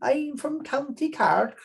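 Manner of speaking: high, slow, put-on Kerry Irish accent; jokey Irish accent for a player named Hanlon. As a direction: ham up a Kerry Irish brogue, drawn out. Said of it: "No, that's a Kerry accent".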